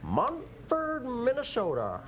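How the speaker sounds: high-pitched mock Minnesota accent. Host plays a Minnesota guy selling Mumford, mock Minnesota accent. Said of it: "That was a Minnesota guy talking".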